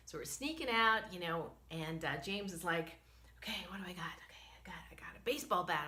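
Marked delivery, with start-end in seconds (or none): 3.4-5.8 quick unvoiced whisper; retold whisper. Husband sneaking toward bear, quick whisper. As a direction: whisper it fast while sneaking up.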